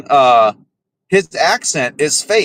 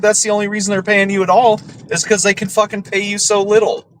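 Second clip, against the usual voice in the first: normal talking voice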